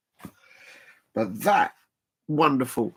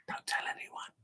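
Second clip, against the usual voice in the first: mock secret whisper; 16 dB quieter than usual. Teasing the chat about the parrot's small feet, in a conspiratorial whisper. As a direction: whisper it like gossip, barely voiced.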